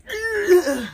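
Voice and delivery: high, loud exasperated groan; loud high ugh, fighting the urge to curse; frustrated ugh. Real, not acted. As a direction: let out a strained ugh of frustration. Her reaction to cursing restraint.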